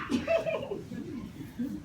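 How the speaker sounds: quick, breathy laugh; short laugh. Real, not acted. Laughs after admitting not well.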